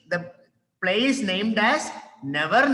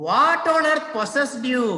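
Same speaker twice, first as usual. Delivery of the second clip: exasperated demo; 7 semitones higher, 4 dB louder than usual. Shown as a demonstration. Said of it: "Remember the phrases that I have taught you".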